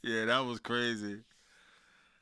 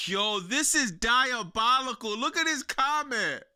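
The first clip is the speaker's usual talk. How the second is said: excited disbelief; 8 semitones higher, 4 dB louder than usual. Real, not acted. Streamer reading a wild comment, amazed and loud. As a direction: hit it like you can't believe what you're reading.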